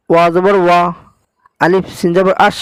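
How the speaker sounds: ordinary delivery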